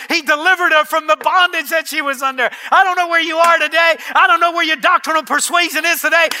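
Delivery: high, loud, driving proclamation; impassioned, building revival preacher; full-force preaching. Real, not acted. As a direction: punch out each promise to the congregation.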